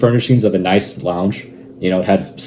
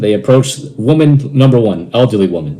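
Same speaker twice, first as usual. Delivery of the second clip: ordinary delivery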